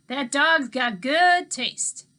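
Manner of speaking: put-on easygoing old man talking to kids; Mookie's voice. A narrator's reading. Mookie chuckling.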